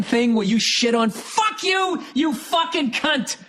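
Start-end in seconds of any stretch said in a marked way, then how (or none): 1.1-3.4 sudden high "fuck you", then a slow snarl; high, slow angry cursing; angry outburst. Real, not acted. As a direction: lash out at the commenter.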